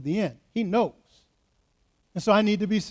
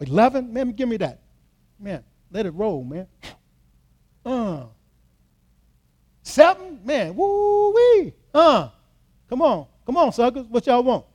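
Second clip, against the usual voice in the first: dice gambler; 4 semitones higher than usual. Acted.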